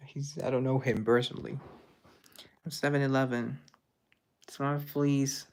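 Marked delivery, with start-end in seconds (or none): none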